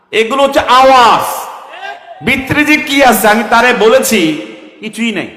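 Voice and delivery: loud, high preaching at full force; fiery preaching. Acted. Preacher rouses the gathering, loud and high. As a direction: hammer the crowd like a fiery preacher.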